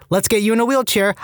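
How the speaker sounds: put-on high, slurred rapper accent; lazy drawled rapper accent, drugged. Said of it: "Think Fetty Wap type accent".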